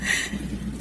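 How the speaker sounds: breathy scornful laugh under the breath; bitter laugh. Acted. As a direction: scoff at his excuse with a quiet laugh. Scornful laugh at husband's excuse. (mapait na tawang pabulong)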